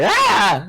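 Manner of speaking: short high yelp; quick pained yell, hit by a car; mock scream. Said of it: "I think I can scream like".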